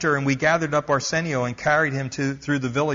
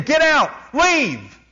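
much higher, louder, slow drawn-out shout; angry yell; 13 semitones higher, 7 dB louder, much slower than usual. A narrator's reading. Missionary yelling at the medium a second time, furious shout. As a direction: throw her out with everything you have. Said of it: "I yelled at her again".

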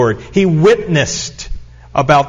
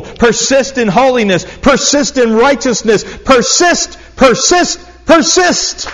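from a whisper up to a much higher, louder shout; building exhortation; 7 semitones higher, 3 dB louder than usual. Acted. Preacher urging the congregation to persist, whisper to shout. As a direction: build from hushed urging to a full-force cry.

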